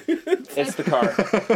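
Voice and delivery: slow, high, laughing tease; slow teasing. Acted. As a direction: drag it out to tease her. Teasing friend about speeding.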